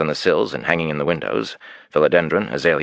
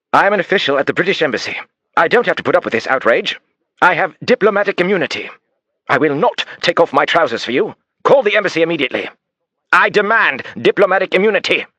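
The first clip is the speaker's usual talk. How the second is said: high, loud, furious English bluster; outraged demand; 13 semitones higher, 4 dB louder than usual. Acted. Suspect shouting at the detective for diplomatic immunity, furious bluster. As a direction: rant for immunity at full pitch.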